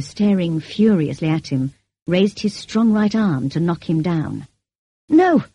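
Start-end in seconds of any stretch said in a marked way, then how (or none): 5.1-5.4 high, loud terrified cry; frightened cry. A narrator's reading. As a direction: shout it out in panic. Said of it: "cried Mason, trembling".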